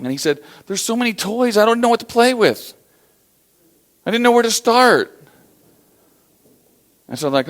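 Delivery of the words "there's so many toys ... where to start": put-on high, whining child voice, close to tears; a spoiled kid wailing over too many toys; whiny crying kid. Kid in the toy house, high whiny complaint. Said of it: "heard weeping and crying".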